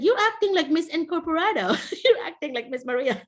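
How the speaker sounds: laughing through a teasing quote; student laughing at the teacher's new habit; laughing tease. Retold or imitated. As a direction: giggle while you tease the teacher.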